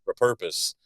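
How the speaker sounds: "repurpose" with weak first syllable, stress on PUR; second-syllable stress. Retold or imitated. Southerner showing a Midwest pronunciation, ruh-PUR stress in "repurpose". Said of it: "somewhere in the middle or the second half".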